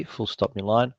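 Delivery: dictating. Real, not acted. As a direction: drop the voice to dictate punctuation. Dictating punctuation to software.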